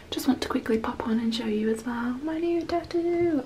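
hushed whisper. Real, not acted. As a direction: keep it to a whisper. Boyfriend still asleep next door.

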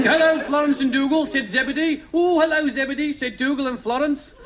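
high, singsong storybook greetings; children's story parody. Acted. Zebedee, Dougal and Florence exchanging hellos, high storybook voice. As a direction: read it as mock children's television.

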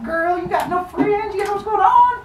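very high put-on squeal; sassy supporter. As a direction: heckle with hammed-up sass. Supporters trash-talk in story.

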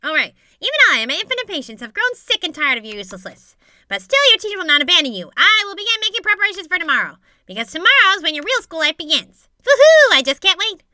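high, loud, theatrical announcer; a self-important teacher proclaiming to the class; hammed-up, high-pitched proclamation; pompous teacher character. Voices a game character's announcement.

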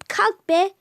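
mild mean bark; rude snap. Acted. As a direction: order her up like a bully sister. Sister bullies Cemile.

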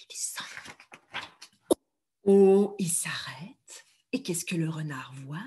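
tense hushed whisper. Shown as a demonstration. Teacher narrating the fox creeping, whisper. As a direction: build suspense in a whisper.

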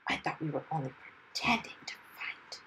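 hushed, breath-only reproach; fierce whisper. A narrator's reading. Dragon reproaching hero mid-fight, fierce whisper. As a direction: hiss it privately into the ear. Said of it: "he whispered fiercely".

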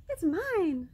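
high, loud and sunny; smiling sun. A narrator's reading. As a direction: beam the claim out. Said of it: "smiled the sun".

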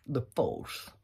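"The force" said softly with a bad English accent; quick mock English accent on "The force"; lazy English accent, "The force" read the English way. Shown as a demonstration. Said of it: "j'ai dit le mot français avec l'accent anglais".

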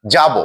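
যাব as "jabo", ending in o; pronunciation demo. Shown as a demonstration. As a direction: say যাব carefully with a rounded final "o". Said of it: "উচ্চারণ হবে".

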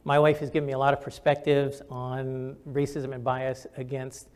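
usual speaking voice

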